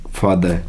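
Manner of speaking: "फादर" said as "faa-duh", no r; silent r. Shown as a demonstration. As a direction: end "फादर" on an open schwa. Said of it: "आर का उच्चारण नहीं होता".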